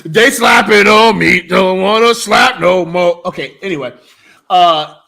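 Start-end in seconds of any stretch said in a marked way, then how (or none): 0.0-3.2 hammed-up moan. Joking about big meaty men.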